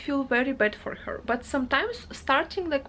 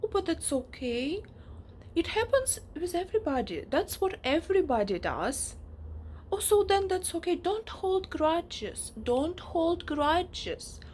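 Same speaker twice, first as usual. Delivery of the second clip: mock placating others; 6 semitones higher than usual. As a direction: imitate people brushing it all off. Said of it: "and everybody is like".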